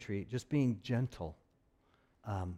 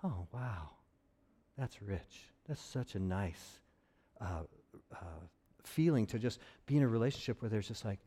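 quiet awe; 4 semitones lower, 7 dB quieter than usual. Retold or imitated. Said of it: "being around that person was like".

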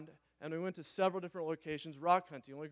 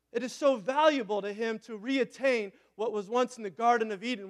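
louder, fervent sermon delivery; preaching; 4 semitones higher, 5 dB louder than usual. Acted. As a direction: preach it with rising conviction. Preaching about God and Eden.

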